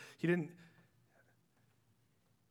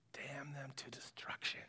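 unvoiced, menacing whisper; a whispered damning of Ammon; whispered curse; 6 semitones lower, 14 dB quieter than usual. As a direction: hiss the curse low and quiet.

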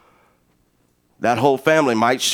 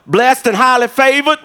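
lightly hammed-up churchy answer, higher and louder; mock pious stock reply; 9 semitones higher, 4 dB louder than usual. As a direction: parody a grumpy saint's chirpy reply. Mimicking grumpy churchgoers' stock answer.

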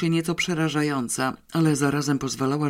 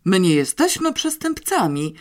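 deadly indignant protest; 5 semitones higher, 4 dB louder than usual. A narrator's reading. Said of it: "ze śmiertelnym oburzeniem".

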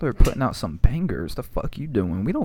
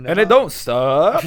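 hype man; 5 semitones higher, 9 dB louder, slower than usual. Pretend. Hip hop catchphrase.

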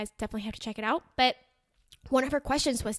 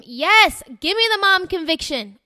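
excited; 6 semitones higher, 10 dB louder than usual. Real, not acted. Cheering on mom conviction.

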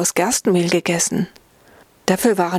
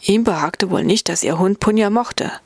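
ordinary delivery

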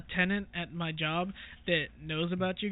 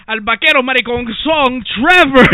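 lucha libre announcer; 6 semitones higher, 17 dB louder than usual. Pretend. Mock wrestling match introduction.

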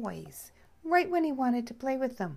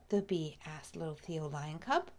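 plain reading voice

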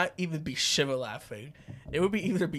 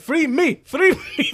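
loud, high chanted plea; mock plea; 10 semitones higher, 8 dB louder than usual. Teacher pleading to the crowd, loud mock chant. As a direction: chant it like a caged protester. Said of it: "He's like".

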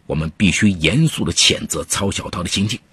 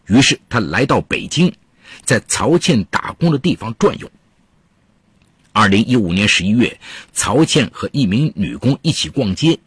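measured storytelling voice; true crime narrator; 4 semitones higher than usual. A narrator's reading. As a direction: tell the case like a radio crime story.

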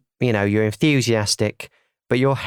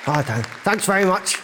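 high badger voice; 6 semitones higher, 4 dB quieter, faster than usual. Badger voice greeting crowd.

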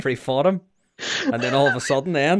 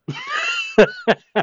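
high squealing laugh; burst of laughter. Real, not acted. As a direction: crack up at your own joke. Laughs at wardrobe attack.

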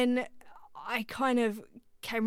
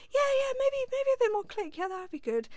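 much higher, tight and anxious; nervous squeak. Retold or imitated. Answering the producer mid-session, a high nervous squeak. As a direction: squeak it out trying to sound fine.

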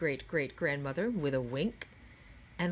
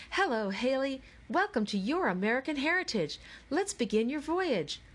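perky guide; 7 semitones higher than usual. A narrator's reading. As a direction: chirp it like a peppy hostess. Perky projected mannequin greets.

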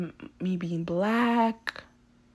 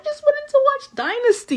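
much higher, squeaky mock whine; whiny high voice; 9 dB louder than usual. Complaining to listeners about missing her show, squeaky whine. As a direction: whine it like a sulky kid. Repeats the wish in put-on voice.

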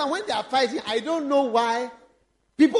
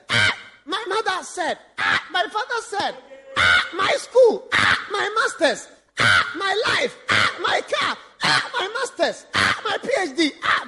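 mock wailing confessor; 7 semitones higher than usual. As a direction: wail each loss, half laughing.

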